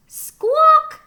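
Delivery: very high, loud squawk; chicken squawk. A narrator's reading. Chicken boarding the tractor, a very high loud squawk. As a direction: screech it like a hen. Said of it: "said Chicken".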